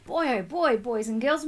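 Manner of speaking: ordinary delivery, nothing marked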